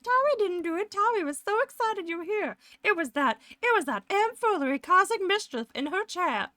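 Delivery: mock innocent excuse. Streamer pretending to blame a guest for mischief, high mock innocence. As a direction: squeak out the excuse like a caught kid.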